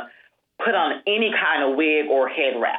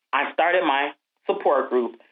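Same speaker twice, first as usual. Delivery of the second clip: usual speaking voice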